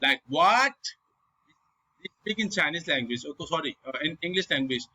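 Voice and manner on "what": "What" shooting sharply up, high and loud; high, loud rising "What". Shown as a demonstration. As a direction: ask "What" with a steep upward swoop. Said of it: "like you are speaking normally and then you speak".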